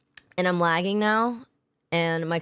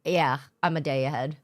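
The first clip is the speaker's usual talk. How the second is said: ordinary delivery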